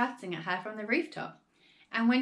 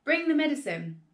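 higher, raised command; urgent yell; 6 semitones higher, 4 dB louder than usual. A narrator's reading. Father grabbing George by the arm, urgent raised yell. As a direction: order him along in excitement. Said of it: "Mister Cranky yelled".

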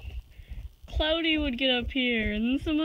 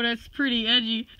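ordinary delivery

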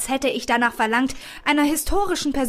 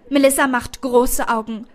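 plain reading voice

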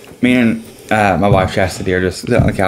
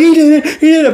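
much higher and louder, euphoric; ecstatic shout; 5 dB louder than usual. Retold or imitated. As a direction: shout it like your driver just won. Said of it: "I was like".

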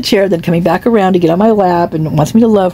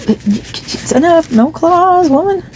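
much higher, slow playful scolding; scolding the cat; 12 semitones higher, slower than usual. Real, not acted. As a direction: tell the cat off sweetly. Scolding cat on her lap.

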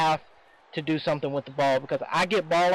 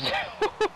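high laugh. Real, not acted. Laughing at a near interception.

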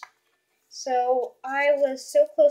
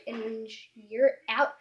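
ordinary talk

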